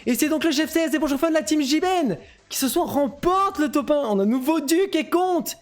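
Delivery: annonce du champion, voix haut perchée; proclamation enthousiaste. Real, not acted. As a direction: proclamer le titre avec exaltation. Proclame le nouveau titre des vainqueurs. (proclamation exaltée, dans l'aigu)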